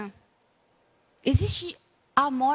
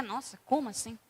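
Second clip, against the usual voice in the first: mild puzzled aside; 4 semitones lower, 9 dB quieter than usual. Retold or imitated. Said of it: "a gente fica".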